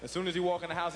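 ordinary talk

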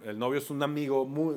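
normal talking voice

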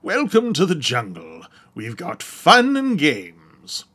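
grand British recitation of rock lyrics; theatrical British oration; orated British accent. Shown as a demonstration. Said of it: "speak and orate the lyrics of songs".

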